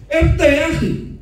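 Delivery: sharp loud order. Retold or imitated. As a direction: snap the order loudly.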